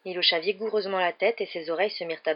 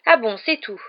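loud, high sarcastic fury; raised voice, furious retort; 5 semitones higher, 8 dB louder than usual. A narrator's reading. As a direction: snap back furiously. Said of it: "dit Harry avec fureur".

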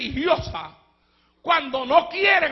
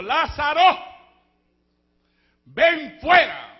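slow, loud call with a long drawn fuera; calling Lazarus out; 2 semitones higher, 5 dB louder, slower than usual. Acted. Christ calling Lazarus out of the tomb, drawn-out call. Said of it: "Le dijo".

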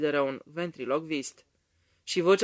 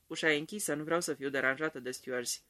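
usual speaking voice

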